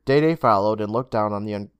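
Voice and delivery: plain reading voice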